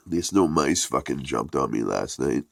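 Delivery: ordinary talk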